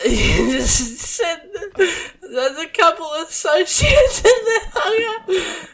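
high squealing laughter through words; laughing hysterically. Real, not acted. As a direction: gasp out words while cracking up.

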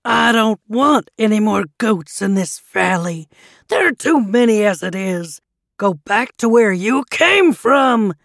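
loud, angry monster voice; booming troll tirade; shouting troll. A narrator's reading. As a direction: roar them away as the troll. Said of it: "he shouted".

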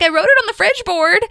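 high goofy protest; dopey Andy. Retold or imitated. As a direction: play it as the innocent goof. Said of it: "Andy's like".

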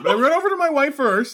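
very high, playful urgency; comic panic. Acted. Player leaving a friend for a dying wife, high comic panic. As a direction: insist in a high mock-frantic voice.

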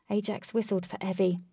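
usual speaking voice

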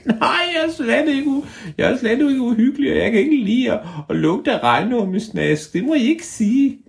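very high squeaky creature voice; tiny high creature voice; ævle bævle voice. Acted. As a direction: squeak back like a cheeky hidden creature.

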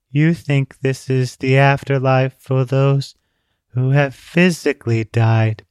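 put-on slow, even, tired girl's voice; weary monotone. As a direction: say it as if nothing could matter. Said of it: "Her voice sounded almost monotone".

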